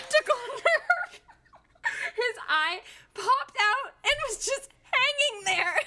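high, laughing while telling the gory part; laughing in horror. Real, not acted. Telling friend the gory dog story, high horrified laughter. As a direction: crack up at the horror of it.

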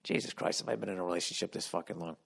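unvoiced, fast muttered aside; breathy-free hushed aside; exasperated whisper. Real, not acted. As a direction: mutter it to yourself in disbelief. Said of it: "aside exasperated".

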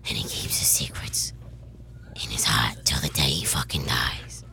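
whispery Boston tough-guy voice; Uncle Lenny. Shown as a demonstration. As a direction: rasp it in an unvoiced Boston whisper. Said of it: "Trying to hear Uncle Lenny".

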